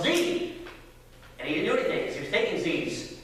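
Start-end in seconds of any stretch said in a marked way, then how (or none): 0.0-0.5 high-pitched calling out; mock yell. Dad yells to wake his dozing friend, mock yell. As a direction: yell it high without real volume. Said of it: "I yelled".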